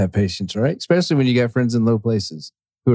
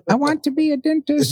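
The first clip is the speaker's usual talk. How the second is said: high, put-on little cartoon voice; mock elf; 13 semitones higher than usual. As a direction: imitate a wistful little elf, pitched way up. Elf quote from Christmas film, teasing.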